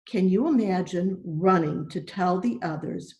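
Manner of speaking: ordinary delivery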